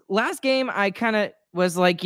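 usual speaking voice

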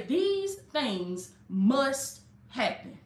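very slow, each word spaced out; slow and deliberate. Shown as a demonstration. Preacher giving the congregation words for the comments, very slow. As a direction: spell it out slowly so they can type it.

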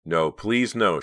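distressed entreaty; pleading. A narrator's reading. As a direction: beg desperately. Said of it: "she pleaded silently".

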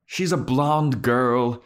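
high, slow, fussy librarian voice; drawn-out, over-explaining the student; high slow British teacher describing a girl; mock Giles. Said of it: "And Giles is like".

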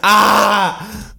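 high, slow, hammed-up pained yell; half-screamed protest, played for laughs; mock scream. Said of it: "I started screaming".

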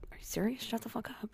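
hushed, high, disbelieving whisper; stunned whisper. Retold or imitated. Attorney stunned by the ruling, hushed whisper. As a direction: breathe it in hushed amazement.

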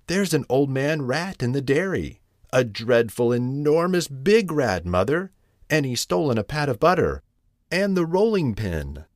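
high-pitched, breathless-with-news storybook kitten; alarmed kitten. Acted. Mittens reports the enormous rat to mother, alarmed. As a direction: pile up the dreadful details for mother.

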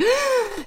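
sharp high gasp of shock; startled gasp. Retold or imitated. To her friend's TikTok idea, a high startled gasp. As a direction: suck in air, stunned by the idea. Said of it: "And I was like".

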